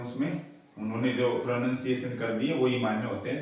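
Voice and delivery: normal talking voice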